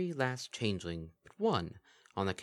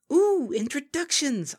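much higher and louder, drawn out slowly; excited squeal; 9 dB louder, slower than usual. Acted. The Kelpie greeting Lyra, slow gleeful coo. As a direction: savour the word like a treat.

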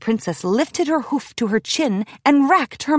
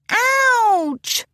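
groaning ouch; 12 semitones higher than usual. A narrator's reading. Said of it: "groaned Sweetie Belle".

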